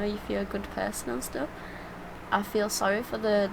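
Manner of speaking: usual speaking voice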